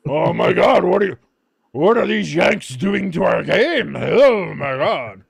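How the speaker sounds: loud, drawn-out mock horror at the Yanks; outraged European purists. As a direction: wail it like a scandalized European purist. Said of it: "all the European purists".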